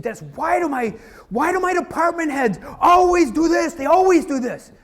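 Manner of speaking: high, loud, exasperated boss yelling; an upset manager yells at his consultant, angrily; angry boss rant. Retold or imitated. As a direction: fire it up like a furious boss demanding answers. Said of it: "And he just fired up".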